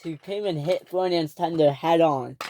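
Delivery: ordinary delivery, nothing marked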